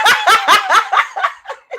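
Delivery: high, loud burst of laughter. Real, not acted. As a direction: laugh out hard. Guest laughs at the joke.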